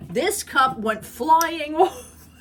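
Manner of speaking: slow, loud and high, breaking into laughter; laughing. Real, not acted. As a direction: laugh the words out as you tell it. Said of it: "I don't know why I'm laughing".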